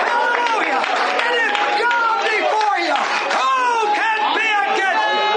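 high, ringing preacher's cry; full-force preaching. Real, not acted. Preacher warning the church against judging, full-force preaching.

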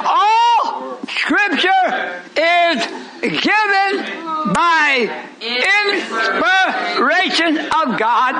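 high, drawn-out scripture declamation; full-force preaching. Acted. Preacher quoting scripture to the congregation, declaimed at full force. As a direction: hammer out each word slowly as it builds.

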